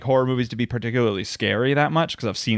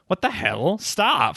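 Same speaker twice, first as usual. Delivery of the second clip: higher-pitched pretend exasperation; mock disbelief; 6 semitones higher, slower than usual. Imagined reaction to a Purge night treadmill runner, mock disbelief. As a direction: call them out in mock outrage.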